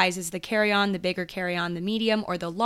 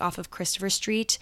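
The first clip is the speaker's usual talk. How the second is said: ordinary talk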